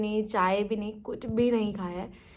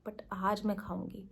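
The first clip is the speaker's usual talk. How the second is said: a quick, secret whisper; 10 dB quieter than usual. Real, not acted. Whispering her junk food plan to viewers. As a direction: whisper it like a guilty little secret. Confessing she'll eat junk today.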